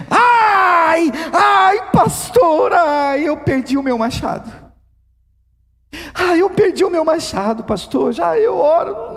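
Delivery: high, wailing lament for the lost axe; desperate believer. Acted. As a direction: cry out like someone who has lost everything.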